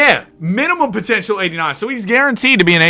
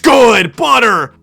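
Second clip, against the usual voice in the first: excited shout; 7 semitones higher, 7 dB louder, much slower than usual. Real, not acted. A clutch shot goes in.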